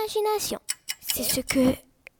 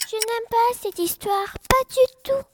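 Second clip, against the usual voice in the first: essoufflé; 8 semitones higher, 5 dB louder than usual. A narrator's reading. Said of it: "disait Lapin tout essoufflé".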